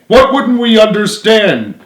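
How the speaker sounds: can't believe it; incredulous. Acted. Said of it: "Joey asked incredulously".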